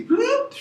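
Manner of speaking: high rising whoop; a quick high whoop as they vanish. Retold or imitated. Describing the room emptying, a high whoop. People vanishing after fellowship.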